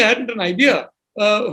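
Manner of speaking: ordinary talk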